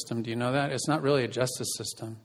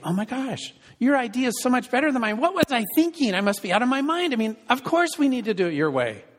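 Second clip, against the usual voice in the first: mock surrender; 11 semitones higher than usual. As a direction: gush like you've suddenly seen the light. Mock concession in fight.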